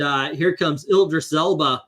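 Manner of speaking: ordinary talk, nothing marked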